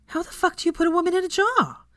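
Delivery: high, urgent hushed-but-voiced question; urgent whisper. Veronica urgently asking across the table, high hushed question. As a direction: lean in, desperate to know. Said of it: "Veronica whispered urgently".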